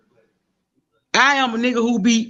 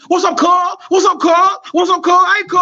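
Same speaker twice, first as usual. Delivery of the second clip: high mock calling out, louder; imitating a street call; 7 semitones higher, 3 dB louder than usual. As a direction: echo the guys yelling outside, pitched higher and louder. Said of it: "Only thing I heard outside my window".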